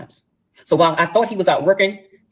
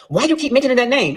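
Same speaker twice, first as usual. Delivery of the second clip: imitating his higher-pitched, indignant challenge; mock Billy Bad; 8 semitones higher than usual. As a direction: play him acting tough and put out. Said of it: "all Billy Bad".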